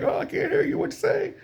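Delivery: high-pitched mock can't-hear-you taunt; gloating taunt. Gloating Viking to the man screaming at him, high mock taunt. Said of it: "he's living it up".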